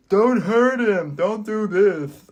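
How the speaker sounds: loud, high, drawn-out imitation scream; mock screaming. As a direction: imitate a boy screaming in protest, slow and high. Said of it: "he's just screaming".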